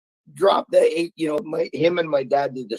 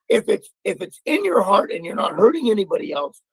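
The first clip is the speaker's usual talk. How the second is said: normal talking voice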